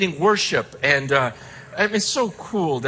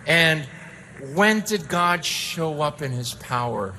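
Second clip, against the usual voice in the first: ordinary talk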